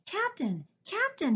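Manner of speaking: high eager calls; calling. A narrator's reading. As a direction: call him through the door. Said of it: "he called".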